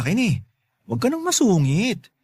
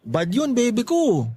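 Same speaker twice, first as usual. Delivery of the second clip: teasing sweet talk; 5 semitones higher, slower than usual. Acted. Sweet-talking her.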